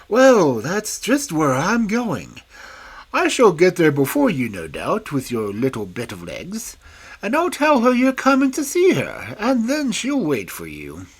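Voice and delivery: put-on a wolf charming the little girl; big bad wolf sweetly flattering the girl; sly wolf. Wolf character voice.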